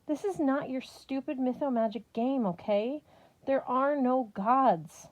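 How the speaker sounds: frustrated sister denying the gods; exasperated. Acted. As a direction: scold him, then insist. Sister angry at brother.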